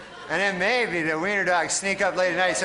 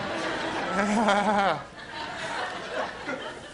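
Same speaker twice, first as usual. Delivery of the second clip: real laugh; 3 semitones higher, 4 dB quieter than usual. Real, not acted. Laugh after bit.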